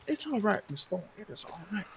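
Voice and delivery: soft, hushed comfort for the widow; gently calm her down; mayor quietly consoling the weeping widow; soothing mayor. Acted.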